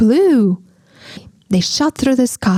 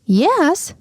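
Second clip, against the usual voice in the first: a high eager cry of yes; bright quick agreement; 8 semitones higher than usual. Acted. Witch welcoming the dog aboard, eager cry. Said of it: "cried the witch".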